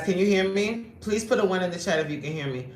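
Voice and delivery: ordinary delivery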